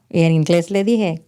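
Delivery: normal talking voice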